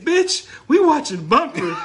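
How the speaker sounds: loud, slow, laughing comeback; loud laughing retort. Retold or imitated. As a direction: shout the punchline through a laugh. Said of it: "I said".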